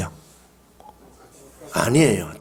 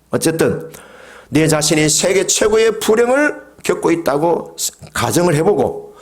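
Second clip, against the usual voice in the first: ordinary talk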